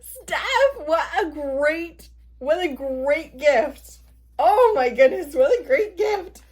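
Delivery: high, laughing gratitude; real delighted squeal to a friend; overjoyed. Real, not acted. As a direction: gush slowly over a wonderful gift. Thanking friend for surprise gift.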